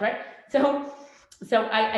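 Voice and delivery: normal talking voice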